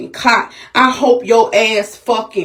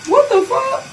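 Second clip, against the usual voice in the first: shocked shriek; 14 semitones higher than usual. Real, not acted. Startled by news of shooting.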